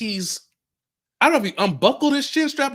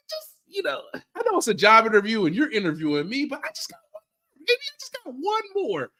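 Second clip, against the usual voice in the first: mock job candidate; 7 semitones higher than usual. Mock job-interview plea, high voice.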